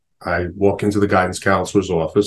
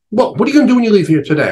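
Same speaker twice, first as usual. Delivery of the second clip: fast and higher, rattling off a question; brisk questioning nun; 11 semitones higher, 3 dB louder, much faster than usual. Retold or imitated. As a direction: fire the question off quickly. Nun quizzes him quickly.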